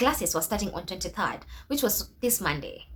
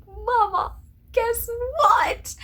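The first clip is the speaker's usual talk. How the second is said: very high breathless whisper-squeal; excited whisper; 6 dB louder, much slower than usual. Retold or imitated. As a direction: burst with news to mom. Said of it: "And I'm like".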